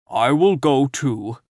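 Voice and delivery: slow, odd Scarecrow pledge. A narrator's reading. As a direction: drawl it out as the Scarecrow. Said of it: "declared the Scarecrow".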